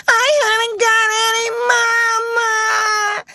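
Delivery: put-on very high, loud childish wail; a little girl sobbing out for her mama; howling spoiled child. A narrator's reading. Lottie to the schoolmistresses, a shrill howling wail. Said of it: "Lottie howled tempestuously".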